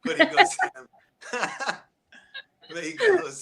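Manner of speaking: giggling through the words; laughing while talking. Real, not acted. Laughing at own bad accent.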